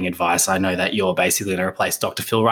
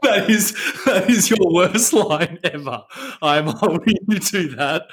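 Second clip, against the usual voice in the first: laughing; 8 semitones higher than usual. Real, not acted. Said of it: "you made me laugh".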